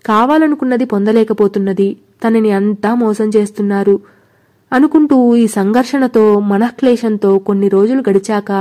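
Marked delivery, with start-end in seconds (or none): none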